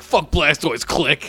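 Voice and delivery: put-on Brooklyn accent. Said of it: "the Brooklyn accent all of a sudden".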